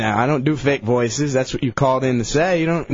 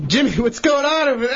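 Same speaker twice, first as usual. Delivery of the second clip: pitched-up alarmed calling out; mock alarmed call; 10 semitones higher than usual. Host calling to the hurt caller, mock alarmed shout. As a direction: call out in fake panic.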